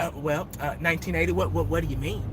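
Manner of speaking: lightly voiced Jerry Jones dodging; imitating Jerry Jones. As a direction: mumble like a flustered owner. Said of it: "He'd be like".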